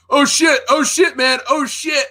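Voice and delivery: high, flustered corpsman imitation; mock panic. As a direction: yelp it like a medic out of his depth.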